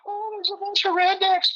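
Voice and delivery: high, squeaky mock-scared voice; mocking scared protester. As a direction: squeak it like a frightened kitty cat. Mocking fleeing Antifa members.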